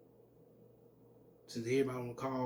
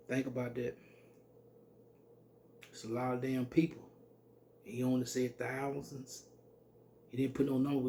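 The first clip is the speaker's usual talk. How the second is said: ordinary delivery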